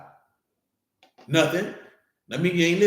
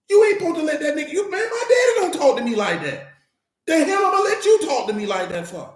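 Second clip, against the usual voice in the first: indignant shout; 9 semitones higher than usual. Acted. Ranting about being disrespected.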